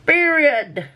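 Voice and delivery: high, finger-snap "Period." with a fall; high sassy period. Pretend. Vlogger ending her rant, high and sassy. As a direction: squeal "Period." like a sassy diva.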